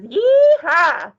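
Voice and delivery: loud cowgirl whoop; cowgirl yeehaw. Acted. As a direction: holler it like a cowgirl. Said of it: "it's the cowgirl fours".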